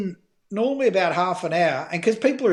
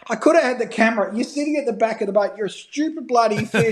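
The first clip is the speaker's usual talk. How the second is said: higher, mock-angry rant at a fish; mock swearing at fish; 6 semitones higher than usual. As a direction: tell off the fish in a light hammed-up way. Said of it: "just swearing my head off at this stupid fish".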